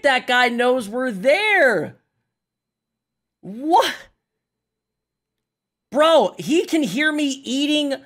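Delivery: high, loud, slow outraged disbelief. Real, not acted. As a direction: drag the words out in outrage. Outraged rant at enemy.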